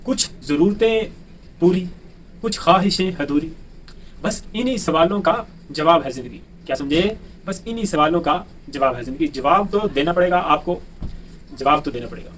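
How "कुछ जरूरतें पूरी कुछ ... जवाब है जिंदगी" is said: a teacher reciting a poem before class; poem recitation. Acted. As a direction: recite it like a couplet at a gathering.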